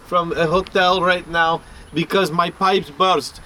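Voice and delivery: a clumsy Russian accent imitation; mimicking a caller's Russian accent; bad Russian accent. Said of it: "really bad accent".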